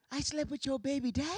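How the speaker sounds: soft put-on voice with a little gasp; hammed-up timid surprise; mock polite shock. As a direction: act scandalized but softly.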